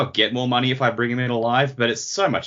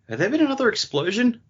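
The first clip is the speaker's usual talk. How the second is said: sudden high startled query; mock surprised character; 13 semitones higher than usual. Cohost voicing a character, high and startled. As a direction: pop up high, puzzled.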